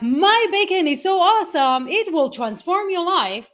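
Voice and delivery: high, slowing hype pitch; gushing, hammed-up sales promise; overexcited seller. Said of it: "because we're going like".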